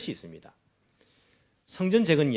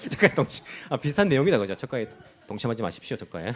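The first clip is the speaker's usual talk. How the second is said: chuckling through the words; amused laughing; 4 dB louder, faster than usual. Real, not acted. Preacher joking with congregation over a misremembered word, laughing.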